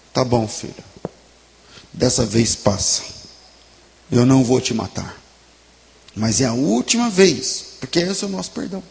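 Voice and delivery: gentle fatherly pardon. Pretend. Father to kneeling son, softly forgiving. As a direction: ease off and let the son go gently. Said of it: "podia olhar pra ele e dizer".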